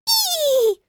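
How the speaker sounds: very high short squeal; high baby squeal. Acted. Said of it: "pisnęła Bella".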